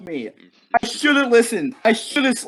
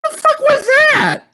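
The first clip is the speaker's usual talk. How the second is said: high, slow, stunned yell; shocked shout; 5 dB louder than usual. Real, not acted. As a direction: shriek it in disbelief. After a scare.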